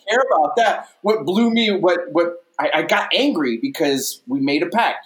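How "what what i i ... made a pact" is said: ordinary talk, nothing marked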